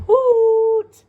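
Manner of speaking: high, loud hooting call; owl hoot. Acted. Teacher hoots like the owl for her pupils. Reading owl's hoot in book.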